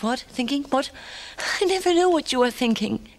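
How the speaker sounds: a wife on edge begging him to speak; nervous woman. Acted. Nervous woman pleading with silent man.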